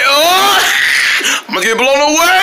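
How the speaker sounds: scared cry. Acted. Blown away by the AC wind, he cries out in fear. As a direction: cry out as the wind takes you.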